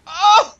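very high loud yelp into a laugh; shrieking surprised laugh. Real, not acted. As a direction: burst out in disbelief. Shocked laugh.

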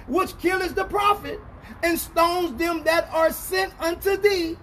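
high, ringing preacher's proclamation; forceful scripture reading. Real, not acted. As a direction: proclaim the verse phrase by phrase, pitched high. Reads scripture aloud at force.

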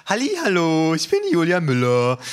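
a presenter sweeping in with a big, high voice; voluminous woman's voice. A narrator's reading. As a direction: boom out a bubbly, oversized greeting. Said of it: "dringt plötzlich eine voluminöse Frauenstimme".

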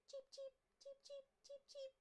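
high tiny cheeping chicks; baby chicks. Acted. Newly hatched chicks on Gerald's head: high cheeping. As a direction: peep like newborn chicks.

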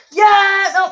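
real yell. Real, not acted. Goal celebration while playing.

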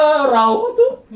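high giggly rauhoitu; laughing calm down. Retold or imitated. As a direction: laugh while telling him to relax. Calming friend, laughing. (naurava rauhoittelu)

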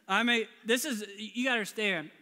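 usual speaking voice

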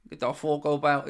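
put-on broad Dublin accent; strong Dublin accent. Showing a viewer what strong Dublin sounds like, broad accent. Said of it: "like, real strong Dublin".

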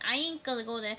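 normal talking voice

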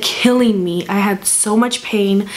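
normal talking voice

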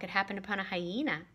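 usual speaking voice

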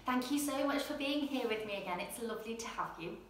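ordinary delivery

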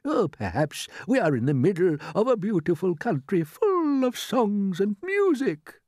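high, dreamy, hopeful voice; old green grasshopper. A narrator's reading. Grasshopper imagining where they landed, high and dreamy. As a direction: let it rise and stretch out at the end.

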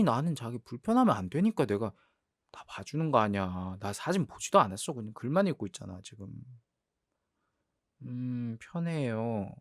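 normal talking voice

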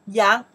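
yang projected loudly as one syllable; model pronunciation. Shown as a demonstration. Teacher pronounces the sheep character for learners, loud yang. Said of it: "This one we pronounce".

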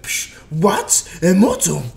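shocked disbelief. Retold or imitated. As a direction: spit it out in disbelief. Goku shocked in retold scene.

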